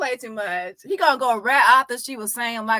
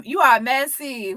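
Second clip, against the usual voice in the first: normal talking voice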